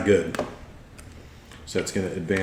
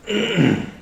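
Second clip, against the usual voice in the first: short throat clear; 11 semitones higher than usual. Real, not acted. Game master pausing before narrating vines, throat clearing.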